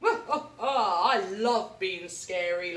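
slow, loud gloating through laughter; laughing. Acted. Alan gloats after a day of scaring, laughing. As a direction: savour every word with a laugh. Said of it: "laughed Alan".

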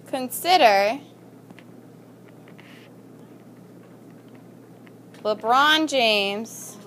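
word by word, drawn out with long pauses; slow dictation. Shown as a demonstration. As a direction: dictate slowly for students writing it down. Dictates a sentence to the class.

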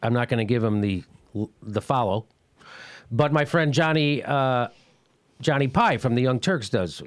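normal talking voice

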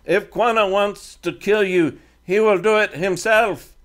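put-on a defiant teen speaking halting English; Comanche boy leader.

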